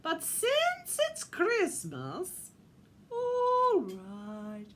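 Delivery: slow, drawn-out, loud put-on voice; strange-accented, stretched-out concession; Missus Claus relenting. A narrator's reading. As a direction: give in slowly and grandly. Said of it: "in her slightly strange accent".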